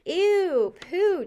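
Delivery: high, loud disgusted squeal. Acted. Thomas squeals at the droppings, high and loud disgust. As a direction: recoil from it loudly.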